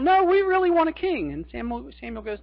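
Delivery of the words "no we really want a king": high, loud insistent plea; the people clamoring, pitched up high; pleading demand. Acted. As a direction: beg the prophet for a king. Israelites pleading for a king.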